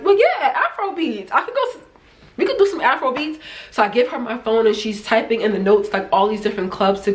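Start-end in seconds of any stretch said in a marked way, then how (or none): 0.0-3.4 high, eager mock excitement; excited agreement. Telling the waitress she wants Afro beats, high excited. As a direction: pitch way up, all in on Afro beats. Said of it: "And I'm like".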